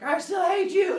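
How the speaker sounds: high, loud holler; mock angry shout. Leto answering Zeus's rescue plan, resentful. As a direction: yell it with comic resentment. Leto yelling at helpful Zeus.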